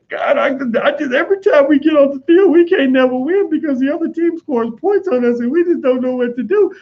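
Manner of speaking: high-pitched whining defeatist wail; mock crying. Football player crying after being scored on, mock whining. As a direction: wail hopelessly, pitched far above your own voice. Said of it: "start crying and get depressed".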